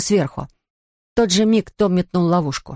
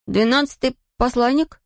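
high puzzled echo of двенадцатый; puzzled question; 7 semitones higher than usual. Acted. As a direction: repeat двенадцатый back in confusion. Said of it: "переспросил Том".

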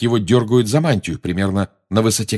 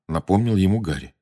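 ordinary delivery, nothing marked

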